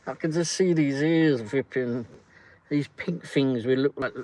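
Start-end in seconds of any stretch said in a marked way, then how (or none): none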